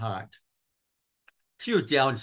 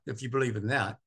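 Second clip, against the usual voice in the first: ordinary talk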